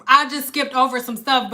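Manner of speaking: usual speaking voice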